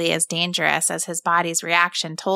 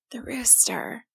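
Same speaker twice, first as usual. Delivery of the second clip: frightened breathless whisper; barely getting the words out, whispered; 3 semitones higher, 9 dB quieter, slower than usual. A narrator's reading. Scared boy whispers to his mother. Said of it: "Tim found that he could manage a whisper".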